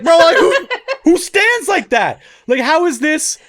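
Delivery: high, loud, incredulous protest; appalled disbelief. Retold or imitated. Babysitter baffled by the kid's pose, appalled disbelief.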